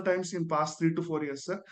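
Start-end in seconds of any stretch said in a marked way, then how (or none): none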